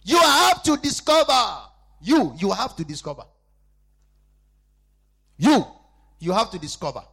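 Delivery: loud, slow, high sermon cry; full-force preaching. Acted. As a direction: drive each word into the congregation.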